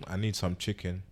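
normal talking voice

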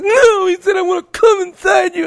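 high, wailing mock sob; hammed-up tearful shout; mock crying girlfriend. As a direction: friend imitating sobbing girlfriend quoting the text, high wail. Girlfriend quoting text, crying.

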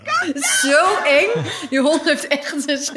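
hoog lachend uitgeroepen; half giechelend, half griezelend; lachend en angstig. Real, not acted. Recalling possessed dog video. (lachende kreet van schrik)

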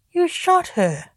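high, slow accusing cry. A narrator's reading. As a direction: accuse him, voice rising. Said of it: "said John accusingly".

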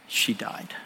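breathless whisper of the fear; hushed, frightened whisper; whispered dread. Retold or imitated. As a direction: whisper it like a terrible thought.

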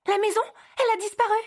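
high fast gasp that the house is gone; shocked. Acted. Elf cries out to Santa that the house has vanished, high and quick. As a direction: blurt it out in disbelief.

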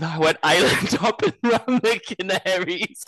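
high, laughing mock-proud boast; laughing quote. Laughing as she quotes Michael's island-hopping boast. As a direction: quote him through giggles. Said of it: "He said".

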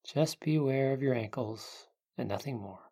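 guided meditation. Acted. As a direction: ease them into calm, unhurried.

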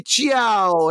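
mocking how the co-host drops Italian words. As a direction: toss out "ciao" with a put-on Italian flourish. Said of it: "Marco is like".

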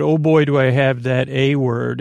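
normal talking voice

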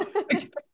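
a short high laugh. Real, not acted. Laughing at own joke.

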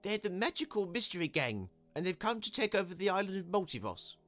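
high-pitched small man's voice; announcing. A narrator's reading. Small man replying to the crowd, announcing.

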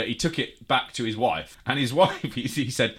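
normal talking voice

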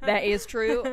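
playful put-on southern accent. Admitting the habit to a friend, southern accent. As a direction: own up in a mock southern accent. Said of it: "I was doing it in a southern accent".

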